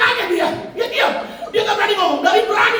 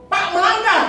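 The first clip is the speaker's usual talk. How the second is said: calling out; 10 semitones higher, 5 dB louder, slower than usual. Retold or imitated. Scolding a traffic violator.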